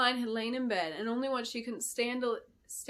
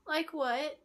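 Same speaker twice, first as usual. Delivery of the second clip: a hint of a high child asking; curious apprentice, a touch higher; lightly voiced little girl; 6 semitones higher than usual. Girl questioning the queen, lightly voiced child. Adeline asks.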